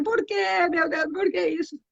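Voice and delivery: high, wailing cry of someone hit by bad news; despairing cry. Imitating a grieving person crying out to God at the news. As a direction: wail it out as if you just heard of a death.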